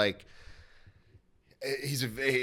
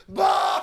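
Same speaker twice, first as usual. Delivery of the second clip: mimicked crowd roar; 5 semitones lower, 6 dB louder than usual.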